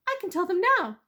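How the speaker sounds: high, loud persuasive mouse; a small mouse talking his way out; clever mouse voice. Acted. Mouse persuading the weasel, high and eager.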